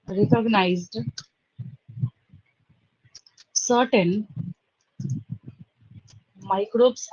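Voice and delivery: word by word, long pauses, low voice. Real, not acted. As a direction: dictate as you write, one word at a time. Dictating while writing out the statement. (धीरे-धीरे, शब्द-दर-शब्द लिखवाते हुए)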